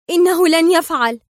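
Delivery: high, loud, vexed denial; exasperated outburst. A narrator's reading. Sonia contradicting her captor, exasperated. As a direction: burst out with resentment. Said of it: "هتفت سونيا في غيظ".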